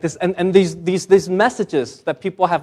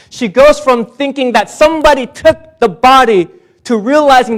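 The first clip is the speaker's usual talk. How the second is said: high, loud sermon build; rising, ringing Easter preaching; full-force preaching; 7 semitones higher, 6 dB louder than usual. Acted. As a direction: lift the congregation toward the climax.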